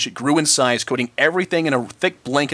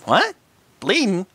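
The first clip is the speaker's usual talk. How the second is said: surprised; 4 semitones higher, slower than usual. Acted.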